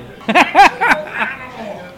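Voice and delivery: high burst of laughter; loud laugh. Real, not acted. Laughing at argument.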